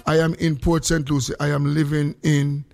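usual speaking voice

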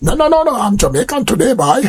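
high-pitched, protesting mock Trini accent; badly faked Trini, insisting playfully. A Trini insisting on being Jamaican today, imperfect Trini accent. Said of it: "My Trini accent not sound too right".

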